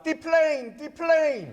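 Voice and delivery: high, loud joking shout. Retold or imitated. To the crowd, feeling small in the photo: high loud shout. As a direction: yell it out like a tiny man. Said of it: "shouted out".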